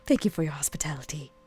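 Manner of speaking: whispered, knowing thanks; sly whisper. Acted. Vera slyly thanks Susie.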